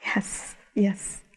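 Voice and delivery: whispered, breathless yes yes; awed whisper. Retold or imitated. Woman stunned by the Lord's answer, whispering. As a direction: breathe it out in wonder.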